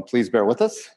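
ordinary delivery

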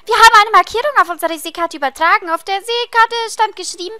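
excited game character. Acted. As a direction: burst in loudly with eager news. Reads game dialogue aloud as a character.

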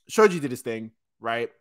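ordinary talk, nothing marked

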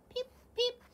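high little peep; robin peep. Acted. Robin second time.

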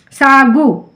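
normal talking voice

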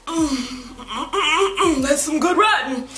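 high, lingering appreciative hums; savoring. Retold or imitated. Reader recalling a scene, savoring the writing. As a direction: relish the prose like a good meal. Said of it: "and I'm just like".

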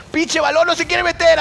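high-pitched exclamation at the ball; worked-up outburst. Real, not acted. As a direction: cry out at the stubborn ball. Rages at the ball.

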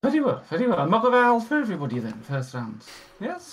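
high, flustered barmaid, stammering her welcome; a nervous tavern maid, voice up high and eager. Acted.

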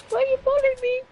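high squeaky whiny plea; mock whine. Streamer whines at friends stealing his subscribers, high and mock-hurt. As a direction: whine it like a wounded kid.

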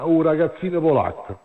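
normal talking voice

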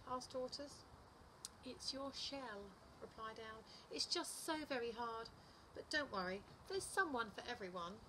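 animated picture-book narration, pitch swinging up; lively storytime voice, key words punched; story reading to children. A narrator's reading. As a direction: read it to little children with big expressive emphasis. Reads second story aloud.

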